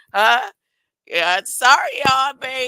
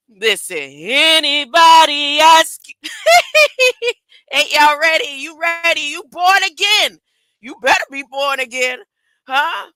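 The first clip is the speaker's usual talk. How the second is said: louder, higher preacher's call; loud preaching cadence; 4 semitones higher, 6 dB louder than usual. Acted. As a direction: rouse the crowd like a pulpit preacher. Preaching at the audience.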